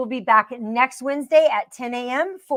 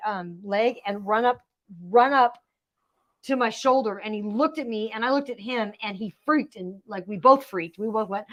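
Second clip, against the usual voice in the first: usual speaking voice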